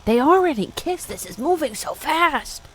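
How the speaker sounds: high, giddy squeal of disbelief. Real, not acted. Reader giddy that they kissed so fast, high. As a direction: squeal like a fan. Excited fangirl reaction.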